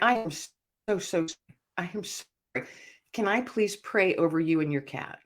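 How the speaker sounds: soft, halting retold condolence; tender and hushed, reliving the moment; gentle sympathy. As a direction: offer comfort quietly, with small pauses. Retold condolence to crying woman.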